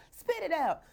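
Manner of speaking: high repeat through a laugh; laughing echo of the order. Shown as a demonstration. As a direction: say it again, laughing.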